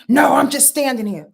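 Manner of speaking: loud defensive snap back; mock-yelled defensive answer; acted screaming retort. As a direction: blurt it loudly, like a man yelling back. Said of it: "you just screaming at me like that".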